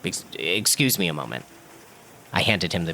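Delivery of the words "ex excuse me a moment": a shaken, faltering excuse; dumbstruck stammer. A narrator's reading. Mayor stammers to the imposter, dumbstruck and halting. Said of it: "too dumbstruck to voice my alarm".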